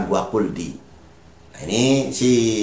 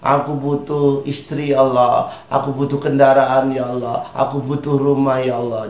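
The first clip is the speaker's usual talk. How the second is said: tearful plea; 5 semitones higher than usual. Acted. Preacher models asking Allah for needs, acted tearful pleading. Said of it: "Apalagi sambil menangis".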